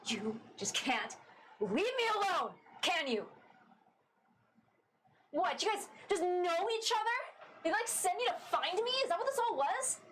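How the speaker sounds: put-on angry runaway confronting her old crew; angry Astra. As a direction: lash out at the friends who tracked her down.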